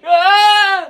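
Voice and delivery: high, drawn-out yelp of shock; startled scream. Acted. Teacher and student surprise each other, high startled scream. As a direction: cry out at being caught.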